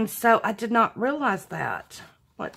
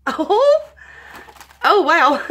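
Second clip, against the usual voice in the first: delighted surprise; 10 semitones higher, 8 dB louder, slower than usual. Real, not acted. Confetti spills out of envelope.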